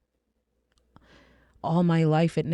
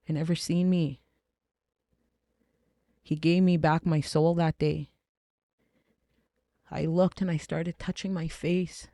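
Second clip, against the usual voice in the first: normal talking voice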